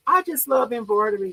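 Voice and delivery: ordinary talk, nothing marked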